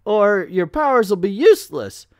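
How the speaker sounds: loud, high-pitched hailing voice; hammed-up booming instruction; doctor calling out. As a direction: holler the reminder with cartoon urgency. Acts doctor calling out a reminder.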